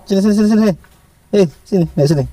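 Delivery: high sing-song "sini, sini" call to a pet; coaxing the pet. Real, not acted. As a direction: lure the animal up to you.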